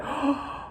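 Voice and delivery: high breathless gasp; mock crowd gasp. Train station crowd watching him get punched, gasping. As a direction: gasp like shocked onlookers. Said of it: "everybody looking at me like".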